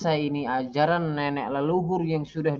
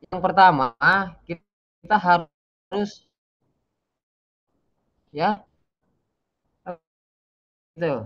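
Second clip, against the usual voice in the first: breaking up space radio; 4 semitones higher, 5 dB louder than usual. Real, not acted. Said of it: "Tadi Ustadz suaranya putus-putus".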